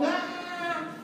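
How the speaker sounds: high, breathy mock shriek at a mouse; imitating a startled woman's squeal; mock scream. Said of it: "las señoras gritan".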